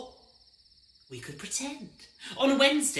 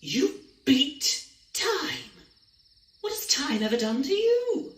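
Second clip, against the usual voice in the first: shocked; 5 semitones higher, 4 dB louder than usual. Acted. Shocked at beating time.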